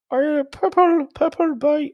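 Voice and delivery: very high, hopeless Scottish accent; attempted Scottish accent. Shown as a demonstration. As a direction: squeak out a bad Scottish accent. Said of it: "Oh, I can't do it".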